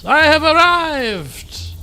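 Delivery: slow, high, loud proclamation; Volpus's grand entrance. Acted. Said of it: "he says".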